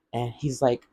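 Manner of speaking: ordinary delivery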